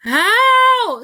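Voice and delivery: long, high, loud yelp; loud drawn-out "ow" shriek; scream of pain. A narrator's reading. Riskiro loses his tail to the knife, screaming. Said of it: "screamed Riskiro".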